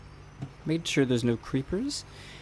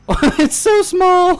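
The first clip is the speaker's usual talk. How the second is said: much higher, slow, loud squeal of surprise; shocked squeal; 10 dB louder, slower than usual. Real, not acted. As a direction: stretch it out, amazed it's so small. Shocked at tiny building.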